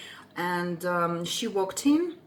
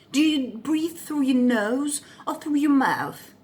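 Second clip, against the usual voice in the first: mimicking the lady's prying question; lightly imitated prying old woman; her tone; 3 semitones higher than usual. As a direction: ask it like the nosy old lady would. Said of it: "This is her tone, by the way".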